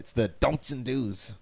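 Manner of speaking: slow tough-guy growl; bruiser voice. Acted. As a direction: drag it out like a bruiser. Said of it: "'cause he's ... like a bruiser".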